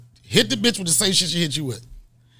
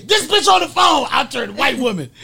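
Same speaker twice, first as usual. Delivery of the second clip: high-pitched, loud shout; comic outburst; 10 semitones higher, 7 dB louder than usual. Acted. Comic telling the audience what he didn't say, shouted. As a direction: yell it way up high in mock outrage.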